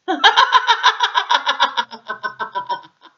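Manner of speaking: loud, high mad laughter; Man fleeing in terror, laughing; horrible laugh. A narrator's reading. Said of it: "Laughing in that horrible way".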